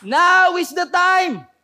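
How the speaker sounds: full-force high battle cry; drawn-out shouted command to an army; Joshua. Acted. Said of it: "sinabi ni Joshua sa mga Israelites".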